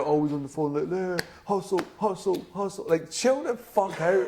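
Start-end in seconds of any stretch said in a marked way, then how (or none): 0.9-2.9 higher mock hustle voice; mocking hustle guy. Mocking phone hustle culture. 2.9-4.3 slow, high, laughing; laughing exasperation. Real, not acted.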